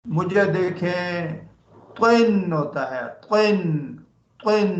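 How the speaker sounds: slow it down and land तिन firmly; slow modelled तिन. Shown as a demonstration. Teacher slowly showing the child how to say तिन. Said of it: "इधर देखें, इधर".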